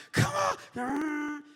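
high, loud mock holler; impatient yell. Sister-in-law yelling from the car, impatient mock scream. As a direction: holler it over the honking.

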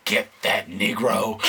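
low, slow villainous squirrel voice; mock-menacing creature, drawn out low. Storyteller voicing the squirrel that chased him, low slow menace. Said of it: "And it was like".

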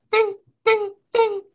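squeaky high-pitched ding ding ding; ping it out high like a plate; high ding imitation. Shown as a demonstration. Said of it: "It makes a ding sound".

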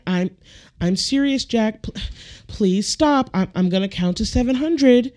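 mild, put-on exasperated parent voice; imitated ineffectual parent. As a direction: scold a child helplessly, all bark removed.

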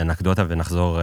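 ordinary talk

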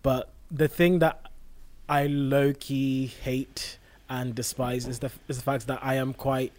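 ordinary talk

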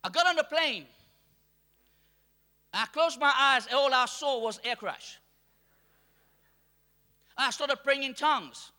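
ordinary talk, nothing marked

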